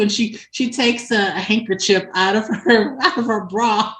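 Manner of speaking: ordinary talk, nothing marked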